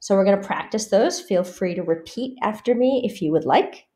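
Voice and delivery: ordinary delivery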